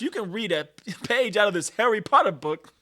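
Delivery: mock challenge.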